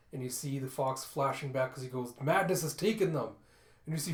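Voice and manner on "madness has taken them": raised, loud cry of alarm; alarmed warning. A narrator's reading. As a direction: sound the alarm urgently. Fox warns amid attack.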